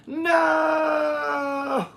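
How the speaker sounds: shocked no. Real, not acted. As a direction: cry out in disbelief at the news. Shock reading autopsy report.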